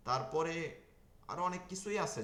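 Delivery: normal talking voice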